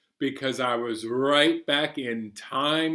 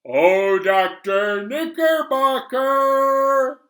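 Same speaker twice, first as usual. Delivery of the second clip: excited announcement; 12 semitones higher, 9 dB louder, slower than usual. Real, not acted.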